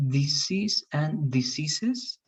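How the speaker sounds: Disease and diseases, slowly; slow model pair. Shown as a demonstration. Teacher modelling the corrected words, slowly. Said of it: "You were saying sometimes good, but again".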